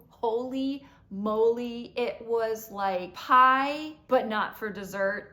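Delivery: loud, slow, drawn-out amazement; bowled over by the food; amazed raving. Real, not acted. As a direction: stretch each word out in loud delight. Raving about how good it was.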